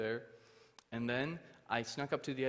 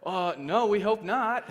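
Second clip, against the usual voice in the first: loud, high, amused protest; comic refusal; 9 semitones higher, 11 dB louder, slower than usual. Retold or imitated. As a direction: refuse emphatically, playing for a laugh. Blunt comic refusal of farewell.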